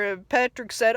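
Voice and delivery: normal talking voice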